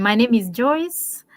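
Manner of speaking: ordinary delivery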